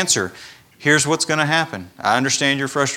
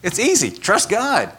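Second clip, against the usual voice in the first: high, breezy dismissal; glib brush-off; 9 semitones higher than usual. Retold or imitated. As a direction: toss it off like it's nothing at all. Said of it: "and we're like".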